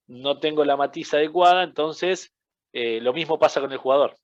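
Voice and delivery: ordinary delivery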